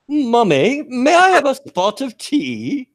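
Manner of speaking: put-on high, drawn-out child voice. Four-year-old asking Mummy for tea, imitated child voice. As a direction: put on a slow, high-pitched little kid asking politely. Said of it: "this little four-year-old redneck".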